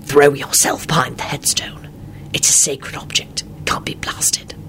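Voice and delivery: urgent whispered instructions; secretive, breath-only whisper. A narrator's reading. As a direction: whisper so the enemy can't hear. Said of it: "Lupin whispered to Elizabeth".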